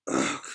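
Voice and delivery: short, deep, soft groan; low pained grunt. Acted. Sabir struck by a rock, low pained grunt. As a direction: grunt as if just hit.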